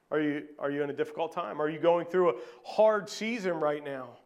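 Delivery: ordinary talk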